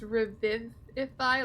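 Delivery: "Revivify" slowly, with VIV as in the name; short-i pronunciation. Shown as a demonstration. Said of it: "like the name Viv".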